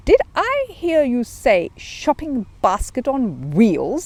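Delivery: slow, drawn-out disbelief; exclaiming. Acted. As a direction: stretch it out in shock. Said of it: "he exclaimed".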